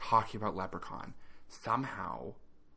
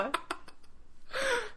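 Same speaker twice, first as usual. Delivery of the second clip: high shriek laugh. Real, not acted. Laughs at vocal fry.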